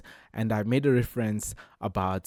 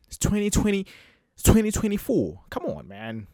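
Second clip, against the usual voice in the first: exasperated exclaim; 12 semitones higher, 4 dB louder than usual. Real, not acted. Exasperated insistence.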